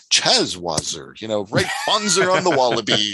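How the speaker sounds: a hint of a mock Australian accent; half-joking Aussie slang in a light Australian accent; Crocodile Dundee. Podcast host mocking Australian slang, light Australian accent. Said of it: "channel my inner crocodile Dundee".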